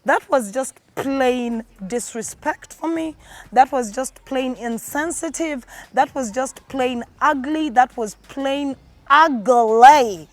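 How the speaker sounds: slow, each PLAIN leaned on; plain stretched out and hit hard; stress on plain. Real, not acted. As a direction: hammer the word plain, slowly. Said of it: "if I could stress the word".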